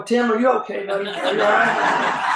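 loud, urgent concern calling out; worried shout. Retold or imitated. Two guys calling to Tim after the crash, loud and worried. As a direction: call out anxiously to a friend in the street.

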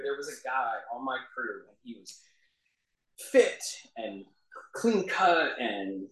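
ordinary delivery

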